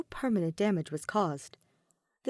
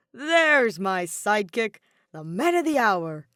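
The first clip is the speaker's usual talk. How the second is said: loud, slow, booming hello; loud cheery call to his sidekick; energetic greeting; 6 dB louder, slower than usual. Acted. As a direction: boom it out across the table. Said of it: "Kaito was always too energetic".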